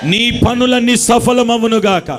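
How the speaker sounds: slow, ringing pronouncement of blessing; proclaiming blessing. Acted. Preacher declaring blessings over the congregation, ringing and slow. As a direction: call each blessing out over the crowd.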